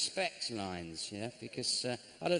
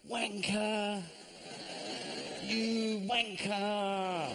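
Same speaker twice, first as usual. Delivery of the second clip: pedestrian horn yell; 6 semitones higher, much slower than usual. Retold or imitated. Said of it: "I went".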